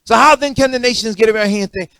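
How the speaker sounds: loud, high, hammering sermon voice; full-force pulpit preaching about divine anger; fiery preaching. Acted. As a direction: preach it out, rising and pounding.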